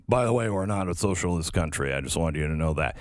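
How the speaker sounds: lightly voiced Swedish prime minister. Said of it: "who's like".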